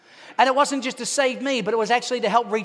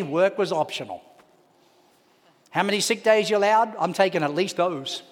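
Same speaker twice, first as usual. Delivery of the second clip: ordinary talk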